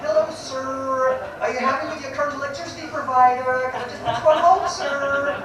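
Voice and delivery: put-on Filipino accent. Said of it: "Like the sort of charming Filipino accent".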